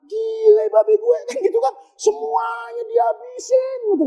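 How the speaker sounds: put-on high, shouted Jakarta kid's rant; hammed-up shocked son yelling about his dad; high mock yell of a son; outraged son. Modern son rants about father.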